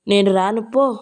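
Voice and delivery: usual speaking voice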